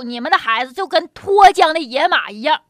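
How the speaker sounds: usual speaking voice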